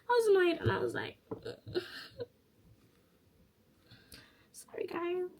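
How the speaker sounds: high voice giving way to tears; choked up and high; real tearful breakdown. Real, not acted. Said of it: "I'm not trying to cry".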